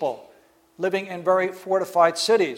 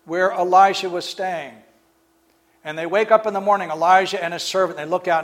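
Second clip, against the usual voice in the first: ordinary delivery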